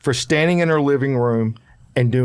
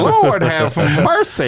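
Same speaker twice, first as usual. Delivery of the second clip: put-on old woman voice; 3 semitones higher, much slower than usual. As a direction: drawl it like a shocked old woman. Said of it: "that's my old woman voice".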